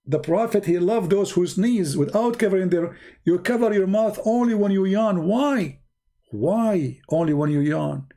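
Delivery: ordinary talk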